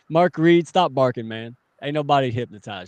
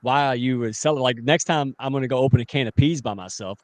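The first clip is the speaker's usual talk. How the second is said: normal talking voice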